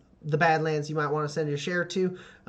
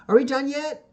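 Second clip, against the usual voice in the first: put-on high whiny child's question; impatient kid; 10 semitones higher, 5 dB louder than usual. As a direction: nag like a tired little girl. Annie the kid interrupts.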